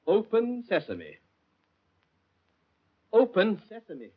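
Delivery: conversational voice command. Acted. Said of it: "If I can only be conversational".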